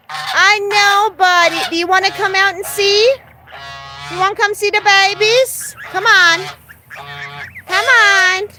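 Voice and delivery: sing-song coaxing to a bird; high coaxing pet voice. Real, not acted. Owner coaxing her parrot to come see babies, high sing-song. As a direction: coo high and bright to coax the bird out.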